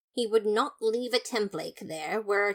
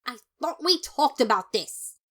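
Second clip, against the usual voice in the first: loud, higher indignant scolding; scolding brother; 4 semitones higher, 6 dB louder than usual. Acted. Papyrus scolding Sans about his gambling, loud and indignant. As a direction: scold him like a fed-up sibling.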